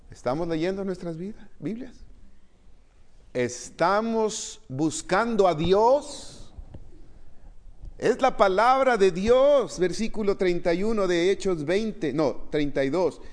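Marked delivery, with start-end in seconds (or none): 3.3-9.8 slow, high and loud with every word stressed; slow preaching emphasis. Acted. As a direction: press the question home slowly. Preacher presses the question. (énfasis lento y fuerte de predicador)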